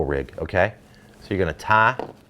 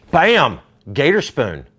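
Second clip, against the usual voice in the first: loud, high punched "Bam!"; big showman's bam; excited exclamation; 6 semitones higher, 6 dB louder, slower than usual. Real, not acted. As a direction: shout it to unveil the product. Showing off a lure with flourish.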